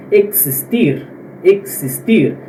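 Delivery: "existir" said "ek-sis-TEER"; X as in táxi. Shown as a demonstration. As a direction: sound the x of "existir" as ks. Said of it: "se pronuncia igual da palavra táxi".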